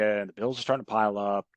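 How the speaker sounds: ordinary talk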